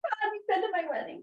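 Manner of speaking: high, strained voice on the edge of tears; barely holding it together; real tearful strain; emotional. Real, not acted. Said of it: "I'm very emotional".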